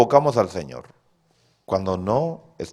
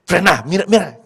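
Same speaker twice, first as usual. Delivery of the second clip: nagging wife; 8 semitones higher, 8 dB louder than usual. Retold or imitated. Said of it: "Y le va diciendo".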